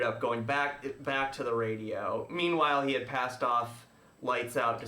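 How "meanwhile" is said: ordinary delivery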